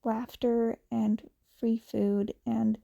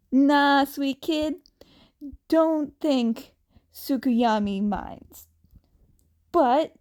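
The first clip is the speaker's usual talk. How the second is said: louder, easygoing concerned hero; a touch of worried big brother; Hawks voice; 4 semitones higher, 4 dB louder, slower than usual. Acted. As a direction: ask gently but loud and casual. Hawks asks why Izuku came.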